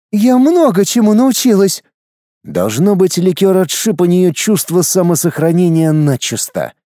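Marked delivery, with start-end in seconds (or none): 0.1-1.8 defiant woman. Acted. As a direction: throw it back at him. Wife defiant retort. (дерзкий женский голос)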